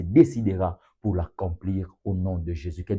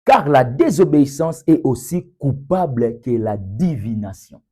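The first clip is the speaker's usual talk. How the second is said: loud, raised-pitch proclamation of a verse; forceful scripture reading; 6 semitones higher, 8 dB louder than usual. Real, not acted. Preacher reading Samuel to the congregation, loud proclamation. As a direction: declaim the verse with full force.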